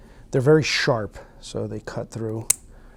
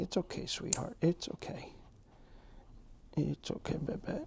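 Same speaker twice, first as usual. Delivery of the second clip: hushed reassuring murmur; calm the dog softly; soothing a scared dog; 4 semitones higher, 11 dB quieter than usual. Real, not acted. Soothing dog during nail clipping.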